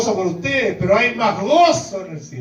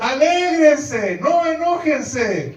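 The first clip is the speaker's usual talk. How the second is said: high, slow, pleading call to rejoice; urgent exhortation; 8 semitones higher, slower than usual. Retold or imitated. Jesus urging the critics to rejoice instead of getting angry, high and slow. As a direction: stretch each word out, urging them to be glad. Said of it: "lo que le está diciendo Jesús".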